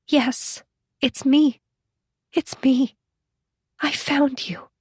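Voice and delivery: high, tearful voice in short broken phrases; understated sob. A narrator's reading. Reunited friend answers the one she searched for, lightly sobbing. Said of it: "Susan sobbed".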